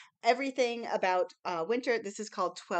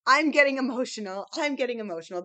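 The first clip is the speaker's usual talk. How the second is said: loud, overwrought pigeon voice, easing off on the repeat; a dramatic cartoon pigeon overcome with feeling; pigeon getting emotional; 3 semitones higher, 8 dB louder, faster than usual. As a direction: ham up the pigeon's gushing emotion. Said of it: "he says".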